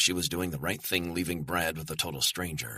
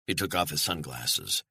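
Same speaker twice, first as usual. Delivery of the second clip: ordinary delivery, nothing marked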